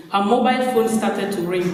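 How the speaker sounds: usual speaking voice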